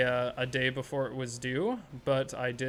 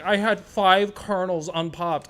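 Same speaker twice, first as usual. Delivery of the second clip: mock whiny reviewer; 4 semitones higher, 6 dB louder, slower than usual. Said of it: "He's like".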